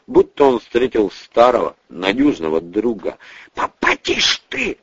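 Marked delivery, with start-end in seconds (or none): 3.6-4.8 pleading. A narrator's reading. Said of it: "взмолился армеец".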